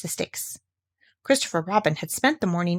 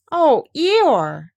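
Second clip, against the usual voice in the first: put-on higher, louder boyish exclamation; a boy's surprised cry, higher and louder; Christopher Robin; 6 semitones higher, 7 dB louder than usual. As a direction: call out to him like a fond small boy. Christopher Robin answers Eeyore.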